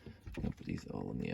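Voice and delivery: usual speaking voice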